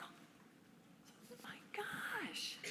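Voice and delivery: slow breathless whispered gasp of disbelief; shocked whisper. Retold or imitated. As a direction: whisper it slowly, stunned. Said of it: "I'm like".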